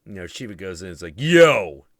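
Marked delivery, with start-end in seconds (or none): 1.2-1.9 louder shout of Yo; loud call. Retold or imitated. Shiva calling out.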